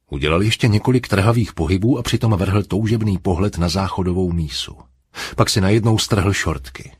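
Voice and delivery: plain reading voice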